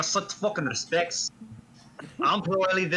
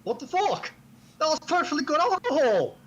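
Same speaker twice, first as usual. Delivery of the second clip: high indignant outburst; outraged over the wasted booze; high pitched indignant squawk; 9 semitones higher than usual. Acted. Outraged at lost bottle.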